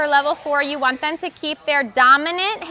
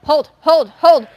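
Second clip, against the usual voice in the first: louder, firm hold hold; called-out cue; 7 dB louder, slower than usual. Real, not acted. Coach cueing a gymnast holding her hollow shape, loud call. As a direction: call it across the gym to keep them tight. Coach calling cues during drill.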